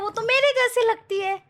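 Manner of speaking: put-on high, small childlike voice; baby monkey telling the butterfly mama looks like it. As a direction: answer simply like a little monkey. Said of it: "baby monkey बोलता है".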